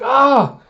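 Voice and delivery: high loud yelp; sudden high cry; startled yelp. Retold or imitated. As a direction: yelp like a kid being grabbed. Anya grabbed by Keith.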